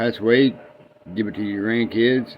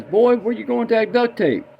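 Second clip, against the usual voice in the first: put-on old man; 11 semitones higher than usual. Old man voiced in joke.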